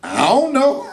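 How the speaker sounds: playing dumb. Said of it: "we're like".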